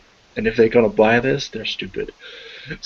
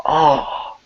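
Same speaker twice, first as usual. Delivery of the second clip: short startled cry, higher; startled gasp; 6 semitones higher, 4 dB louder than usual. Acted. Officer finds the blood, startled gasp. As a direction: jump back with a gasp.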